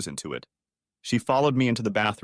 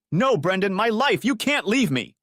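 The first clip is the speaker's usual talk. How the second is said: much higher, loud desperate wail; wailing plea; 11 semitones higher, 3 dB louder than usual. A narrator's reading. Wife begs leaving husband, high desperate wail. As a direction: cry out as he threatens to leave. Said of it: "she wailed".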